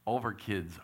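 ordinary delivery

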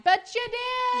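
high, loud excited cheer. Real, not acted. Cheering the listener on.

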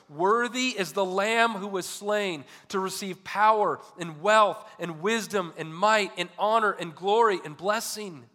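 high, loud scripture proclamation. Acted. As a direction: proclaim it slowly and loudly, like a heavenly chorus. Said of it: "saying with a loud voice".

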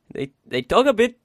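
put-on a quick botched try at a Welsh accent, half laughing at yourself; failed Welsh accent. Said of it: "I might as well try".

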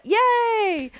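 high, drawn-out fake hooray; mock cheer. Host greeting news of an audit, mock-enthusiastic cheer. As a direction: sing out a sarcastic little cheer.

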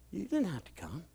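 hushed, gentle reassurance; very soft tender line. Retold or imitated. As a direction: say it almost under breath. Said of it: "I'm like".

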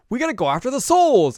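high, loud urgent cry; rally the group loudly; urgent high call. Retold or imitated. Kagome urges the picnicking group, loud high call. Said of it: "and Kokomi's like".

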